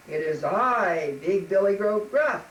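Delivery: loud, slow, imposing goat voice; booming, unafraid storybook beast; big billy goat. Acted. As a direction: announce yourself boldly as the biggest goat. Big goat answers troll boldly.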